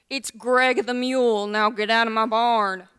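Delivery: loud, gruff mule announcing himself; grumpy mule. Acted. Mule tells the intruding old lady who he is, grumpy and loud. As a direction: throw your weight around as a grumpy mule, loud. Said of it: "Why are you so grumpy".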